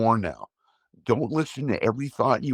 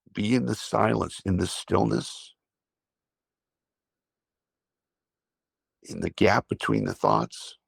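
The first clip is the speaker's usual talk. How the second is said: guided breathing. Acted. Leads a breathing exercise.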